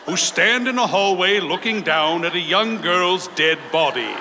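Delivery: ordinary delivery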